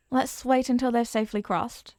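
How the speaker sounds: usual speaking voice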